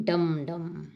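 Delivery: imitating a small drum, dum dum; damru drum. Shown as a demonstration. Teacher shows the class the damru's sound, drumming. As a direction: beat it out like a damru. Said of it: "डमरू की ध्वनि क्या होती है?".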